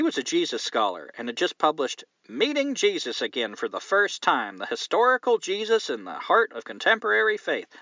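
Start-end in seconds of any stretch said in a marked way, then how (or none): 2.3-7.8 hammed-up Southern accent; Southern accent, pitch swinging up on the big words. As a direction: read the book title with a Southern preacher's lilt. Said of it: "say that in a Southern accent".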